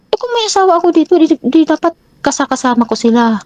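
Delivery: high, fast, defensive imitation of the boyfriend; voicing the boyfriend. Partner's defensive reply voiced. (ginagaya ang boyfriend, mataas at mabilis)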